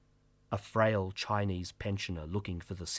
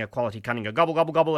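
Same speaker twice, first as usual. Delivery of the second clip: ordinary talk, nothing marked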